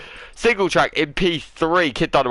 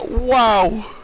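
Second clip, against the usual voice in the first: amazed wow; 7 semitones higher than usual. Real, not acted. Commentator amazed by the lap.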